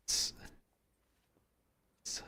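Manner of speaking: halting apology with false starts; awkward, distracted apology. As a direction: stumble into a reluctant, quiet sorry. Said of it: "like, she's busy".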